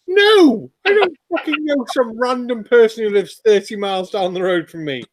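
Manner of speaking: mock outrage at a silly question; exasperated retort. Answering people who assume you know everyone, mock exasperated.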